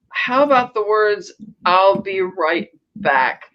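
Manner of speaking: slow, drawn-out mock reproach; pointed retort. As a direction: space the words out pointedly. Retold rebuke from an old flame.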